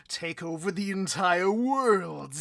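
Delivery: builds from talk to a booming shout on world; rising villain shout. Acted. Villain boss to his right hand, rising to a shout. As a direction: swell to a triumphant roar.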